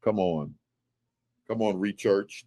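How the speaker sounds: deep low come on. Real, not acted. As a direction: drop the voice way down, knowing. Low reaction to point.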